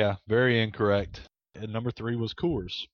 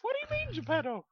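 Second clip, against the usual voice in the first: put-on young boy voice; 4 dB quieter, much faster than usual. Keeps Pinocchio boy voice.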